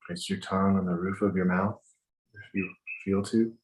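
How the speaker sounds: calm, measured breathing instructions; walk them through each breath gently; guided meditation. Acted.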